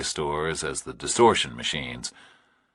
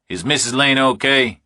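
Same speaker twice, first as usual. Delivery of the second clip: louder, higher, tense; tense Burke; 8 semitones higher, 6 dB louder than usual. A narrator's reading. Burke answering the kidnapper, tense and louder. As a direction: push through nerves.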